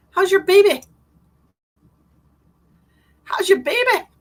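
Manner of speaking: high, loud cooing question; excited greeting. Real, not acted. Excitedly asking viewer about baby.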